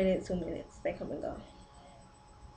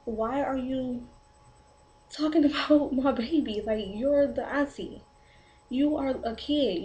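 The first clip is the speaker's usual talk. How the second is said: slow, higher-pitched scolding, each word drawn out; mad retelling; 4 semitones higher, 3 dB louder, slower than usual. Retold or imitated. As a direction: re-enact an indignant telling-off, slowly and emphatically. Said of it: "I was so mad. Like".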